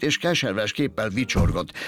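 plain reading voice

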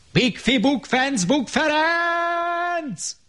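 very high, drawn-out call; shouted out. Acted. Mikka Makka calling out the rhyme to everyone. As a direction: stretch the cry out long. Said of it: "elkiáltotta magát".

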